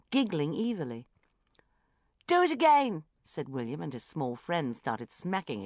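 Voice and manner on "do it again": put-on loud, high cheeky child; gleeful child. A narrator's reading. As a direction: egg the friend on. Boy urging friend.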